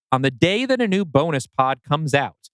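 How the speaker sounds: ordinary talk, nothing marked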